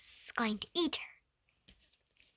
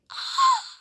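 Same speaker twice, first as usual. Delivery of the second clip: a shocked gasp, very high; sharp high gasp; 10 dB louder than usual. Acted. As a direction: gasp as the wolf falls. Reacting to the wolf falling.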